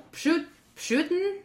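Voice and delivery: "pshut-, Putin" tried slowly with a hushing sh in it, rising; attempted pronunciation. Shown as a demonstration. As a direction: stumble into "Putin" with a sh sound, questioning. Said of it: "And you usually say".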